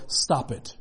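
low, soft, flat-out stern stop; God looking you in the eye and telling you to stop; firm stern command. Retold or imitated. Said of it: "look at you in the eye and go".

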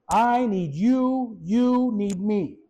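very slow, each word weighted; slow, deliberate preacher's refrain; slow emphatic. Acted. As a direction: lay it down slowly, word by word. Slow spoken refrain, first take.

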